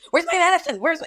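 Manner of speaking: high, loud demanding patient; med-seeking patient. Retold or imitated. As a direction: imitate a patient pestering for drugs. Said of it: "When somebody is med seeking, this is what they do".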